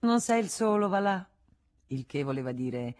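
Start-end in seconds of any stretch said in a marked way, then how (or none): 0.0-1.3 high, louder, teasing pity; Rosanette teasing. Acted. Rosanette kisses Frédéric's forehead, high teasing pity. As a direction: coo at him like a pet. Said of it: "rispose Federico".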